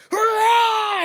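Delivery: high, loud imitated gorilla bellow; gorilla roar. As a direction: let out the silverback's charging cry. Silverback charges at the visitors.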